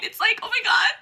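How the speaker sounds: high shrieking gasp; swoon gasp. Real, not acted. Fan swooning at terse hero, squealing. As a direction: gasp as if overwhelmed.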